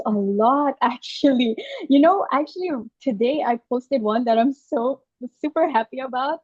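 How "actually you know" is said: usual speaking voice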